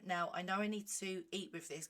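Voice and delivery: normal talking voice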